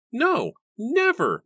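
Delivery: loud, high, slow refusal; furious refusal. A narrator's reading. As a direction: roar the refusal. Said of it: "Matthew declared".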